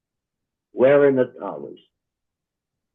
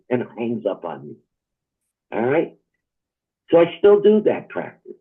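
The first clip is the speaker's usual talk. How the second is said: ordinary talk, nothing marked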